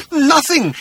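high, earnest denial; insistent Arthur. Acted. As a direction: insist on it anxiously. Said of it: "insisted Arthur".

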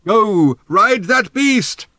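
high, slow, drawn-out holler; shouted command. A narrator's reading. Old man urges the riders on, shouting. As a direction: bellow encouragement after departing riders. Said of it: "shouted Thrashbarg".

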